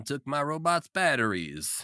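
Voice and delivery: put-on little baby puppet voice; childlike puppet whine; Baby Rolf voice. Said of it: "You do a really good Baby Rolf voice".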